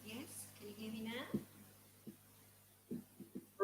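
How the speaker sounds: very faint, far-off voice; faint voice on a weak connection; barely audible. Real, not acted. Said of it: "it needs to be louder".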